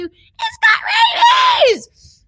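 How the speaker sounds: very high shriek that dies away; panicked scream. Retold or imitated. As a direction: shriek the warning, then fade. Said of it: "we go from this poor little baby to".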